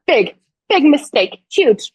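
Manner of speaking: high, slow, gloating Pretty Woman line; Pretty Woman quote. Retold or imitated. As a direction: savor the comeback like the movie scene. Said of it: "I felt like Pretty Woman where she is just like".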